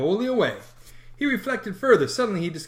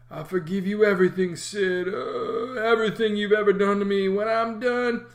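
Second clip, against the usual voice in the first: mock-solemn dying boy; 4 semitones higher than usual. A narrator's reading. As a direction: forgive everyone like on a deathbed. Tom playing dying.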